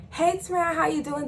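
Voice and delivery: high, chirpy mock hello; imitated phony-sweet greeting; fake-friendly greeting.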